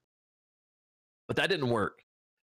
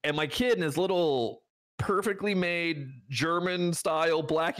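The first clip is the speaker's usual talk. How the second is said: ordinary talk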